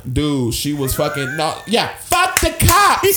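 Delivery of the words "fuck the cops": high, loud, drawn-out mock scream. Storyteller imitating Mary Jane going nuts at police, screaming. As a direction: imitate her screaming at full volume. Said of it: "Like, screaming".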